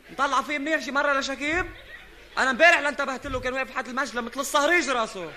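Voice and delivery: normal talking voice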